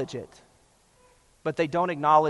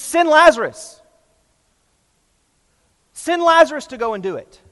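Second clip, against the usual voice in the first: higher, louder order to send Lazarus; insistent command; 9 semitones higher, 8 dB louder than usual. Retold or imitated. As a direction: push the servant out in your place. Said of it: "He's commanding Abraham".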